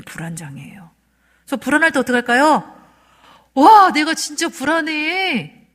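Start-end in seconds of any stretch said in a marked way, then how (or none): 3.5-5.7 high, loud, drawn-out outburst of anxiety; demonstrated venting. Shown as a demonstration. Showing the audience how to let anxiety out, loud venting. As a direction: let the feeling burst out slowly and loudly. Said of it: "이렇게 하셔야 돼요".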